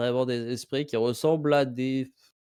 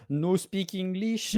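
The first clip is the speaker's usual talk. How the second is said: put-on broken English accent; 7 semitones higher, slower than usual. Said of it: "l'accent américain du point de vue des Chinois".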